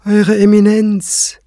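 high, slow, formal address; bow with the voice to His Eminence; deferential reply. Acted. Kranz answering the high priest, high, slow and formal.

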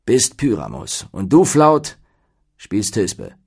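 stately, firmly stressed casting call; pompous troupe leader handing out parts; dignified. A narrator's reading. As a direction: announce each role with weight. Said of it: "sprach er würdevoll".

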